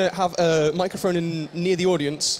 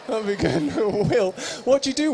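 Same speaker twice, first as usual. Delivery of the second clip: normal talking voice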